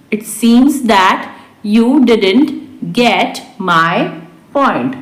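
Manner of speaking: word by word, with pauses; slow repeat. Shown as a demonstration. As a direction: dictate it to learners slowly. Teacher repeats translation word by word. (धीरे-धीरे, शब्द-दर-शब्द)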